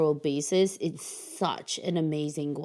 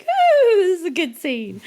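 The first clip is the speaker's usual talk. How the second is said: excited; 13 semitones higher, 9 dB louder than usual. Retold or imitated. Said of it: "I was like".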